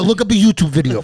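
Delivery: high loud sarcastic retort; telling the thief off, high and loud; mock yell. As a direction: fire it back with a shout. Retort to stranger, shouted.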